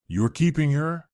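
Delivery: surprised exclaim. A narrator's reading. Friend asking Chloe about the doll, mildly surprised exclaim. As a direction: Felix lightly voiced, amazed she kept it. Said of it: "Felix exclaimed".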